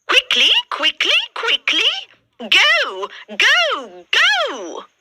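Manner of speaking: very high, shrill shooing; bossy ugly sisters. A narrator's reading. Ugly sisters chasing Cinderella into the kitchen, high and bossy. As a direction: shoo her off, high and bossy. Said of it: "sisters shooing Cinderella away".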